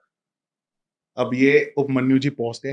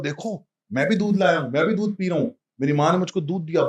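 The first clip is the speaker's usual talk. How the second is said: proud happy boast; 5 semitones higher than usual. Retold or imitated. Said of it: "बड़ा खुश, खुश होकर बता रहा".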